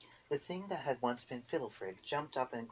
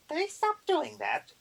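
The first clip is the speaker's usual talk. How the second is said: put-on much higher, slow little old witch voice; Erder voice; 5 dB louder, slower than usual. A narrator's reading. Erder answers the boggart, high prim old witch.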